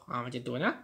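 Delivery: ordinary talk, nothing marked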